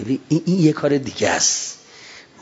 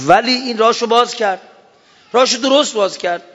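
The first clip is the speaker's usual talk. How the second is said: rousing exclamation; 9 semitones higher, 4 dB louder than usual. Real, not acted. Preacher exclaims in admiration.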